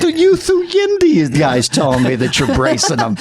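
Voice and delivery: high, laughing mock outrage; hammed-up angry phone call, voice way up; retold outburst at Jay. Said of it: "I go".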